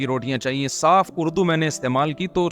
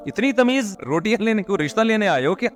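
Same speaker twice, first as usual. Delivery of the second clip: lightly voiced teasing villagers, higher; half-joking village teasing; mocking villagers; 5 semitones higher than usual. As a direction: tease him like the laughing villagers. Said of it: "ہنس بھی رہے تھے کہ".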